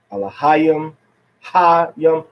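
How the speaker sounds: "Alahayim" stressed on HA; next-to-last stress. Shown as a demonstration. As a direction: isolate and punch the HA in "Hayim". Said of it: "On the next to the last syllable".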